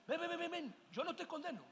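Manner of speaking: a soft, tender Jesus calling ven; tender invitation. Acted. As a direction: coax them in with quiet love. Jesus voiced inviting the sinner.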